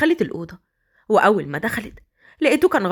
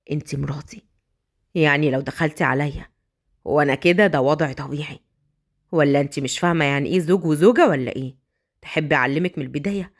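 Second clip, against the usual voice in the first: low, amused teasing; teasing husband; 9 semitones lower than usual. Acted. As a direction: lower and playful, drawing her in. Husband teases wife. (صوت زوج يداعب زوجته بنبرة منخفضة)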